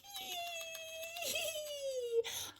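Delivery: long, high, soft squeal; excited squeal. Acted. Butterfly's excitement makes me excited too, excited squeal. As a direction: let out a drawn-out high eee of excitement.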